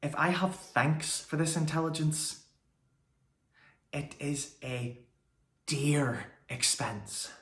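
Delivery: soft, halting verse fading almost to a whisper; subdued Shakespeare monologue. Acted. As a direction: let the speech die away softly, with long pauses.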